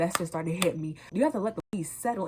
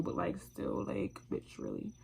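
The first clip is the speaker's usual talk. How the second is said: much softer, breathy trailing gripe; whispered aside; 8 dB quieter than usual. Real, not acted. Whispering a gripe about her unhelpful friend. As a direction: let it fade into a whisper.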